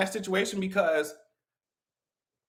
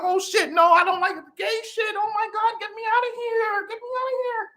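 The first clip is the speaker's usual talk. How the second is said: high, rising whiny panic; mock panic; 13 semitones higher than usual. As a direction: squeal like a scared man begging to leave.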